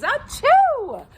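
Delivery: loud, high-pitched mock sneeze; fake sneeze. Shown as a demonstration. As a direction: explode into a big pretend achoo. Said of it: "You could fake a sneeze".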